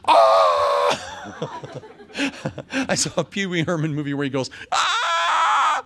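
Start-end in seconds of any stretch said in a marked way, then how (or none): 0.1-1.4 mock scream. [name]'s shocked scream. 4.7-5.8 imitating Pee Wee Herman's high-pitched scream. Preacher imitates Pee Wee Herman's scream from the movie, high-pitched. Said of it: "there's this high-pitched scream".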